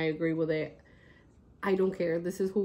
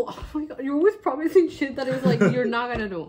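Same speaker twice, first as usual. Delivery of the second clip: exasperated teasing; 5 semitones higher, 5 dB louder than usual. Real, not acted. Teasing sister over empty promise.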